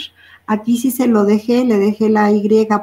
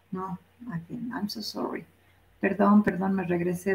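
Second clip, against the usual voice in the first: muttered apology; 10 dB quieter than usual. Real, not acted. Teacher mutters after her mistake.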